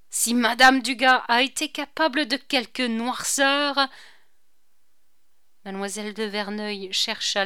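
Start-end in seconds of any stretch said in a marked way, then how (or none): 0.1-3.8 exclaiming. A narrator's reading. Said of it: "s'écria".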